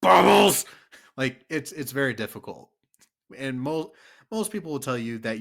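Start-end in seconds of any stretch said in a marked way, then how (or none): 0.0-0.6 loud, high mock-furious shout; angry bubbles. Shown as a demonstration. Friends testing the bubbles challenge, shouted angrily. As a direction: yell it as angrily as you can. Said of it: "you can't say bubbles angrily".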